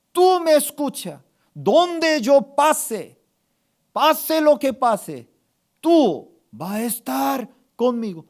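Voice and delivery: high, loud, slow proclamation; fervent declaration. Shown as a demonstration. Pastor models what to tell God tonight, fervent declaration. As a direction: proclaim each phrase with full conviction.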